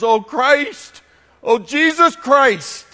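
high, drawn-out imitated cry. Preacher retells Voltaire's dying words, crying out. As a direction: call out slowly, as a dying man would. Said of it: "he cries out".

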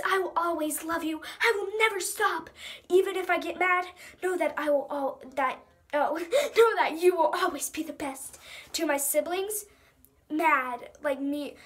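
ordinary talk

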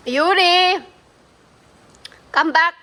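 mother calling her son back, loud and sharp. Real, not acted. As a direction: call him back across the room. Calling child who runs off.

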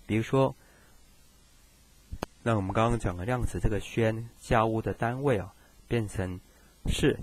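usual speaking voice

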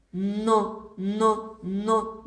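но, но, но chanted clearly; teacher drilling the syllable но; repeated syllable drill. Shown as a demonstration. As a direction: tap out но, но, но for the class. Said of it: "Вимовляємо н".

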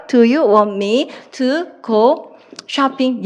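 very slow, word by word; slow dictation. Shown as a demonstration. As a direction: spell it out slowly for students to write. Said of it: "이렇게 쓰시면 됩니다".

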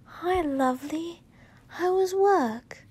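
bright and welcoming, drawn out; sweet greeting. A narrator's reading. Wife greeting her spouse home from work, sweetly.

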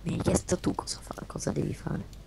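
soft breathy whisper. Real, not acted. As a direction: whisper it back. Whispered reply.

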